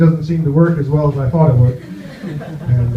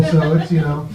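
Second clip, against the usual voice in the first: usual speaking voice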